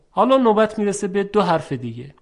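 ordinary talk

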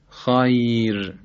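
very slow خیر with a thin final r; light ra. Shown as a demonstration. Teacher repeating the example, drawn-out خیر with thin r. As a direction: hold خیر long and land on a light r.

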